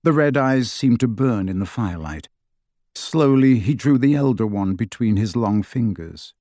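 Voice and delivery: ordinary delivery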